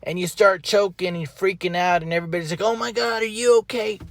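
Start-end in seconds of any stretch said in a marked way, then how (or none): 2.6-4.0 alarmed. Retold or imitated. Family panicking at choking.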